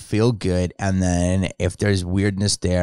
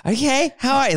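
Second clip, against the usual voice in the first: high, sugary mock greeting; a sarcastic Hollywood hello, much higher; fake-nice sarcasm. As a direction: put on a sweet fake politeness. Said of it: "all sarcasm has to".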